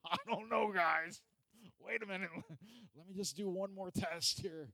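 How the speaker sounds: high-pitched doubtful disciple, hammed-up; Peter. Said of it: "But Peter's just like".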